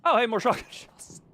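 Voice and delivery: delighted laugh. Real, not acted. Happy find, laughing.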